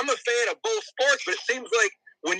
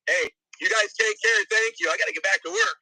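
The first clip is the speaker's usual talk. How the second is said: ordinary delivery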